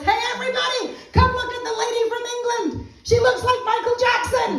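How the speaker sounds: very high, loud retold yell; yelling out the windows. Passengers showing the lady around the townships, yelling out the windows. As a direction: holler to the whole street, hammed up. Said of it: "they're yelling out the windows".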